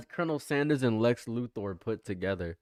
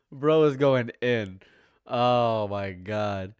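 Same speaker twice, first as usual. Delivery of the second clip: usual speaking voice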